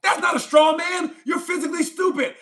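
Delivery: high-pitched, heated outburst; exasperated insult. Real, not acted. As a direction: hurl it at him in exasperation. Insulting a commenter in an argument.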